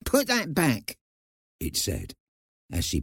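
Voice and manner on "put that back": high, sharp mouse-like command; sharp little voice. A narrator's reading. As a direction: scold sharply in a tiny high voice. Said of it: "speaking to her very sharply".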